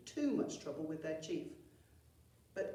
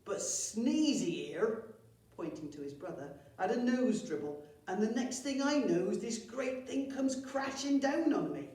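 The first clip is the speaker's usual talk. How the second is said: goblin complaining; 4 semitones higher, 5 dB louder than usual. Acted.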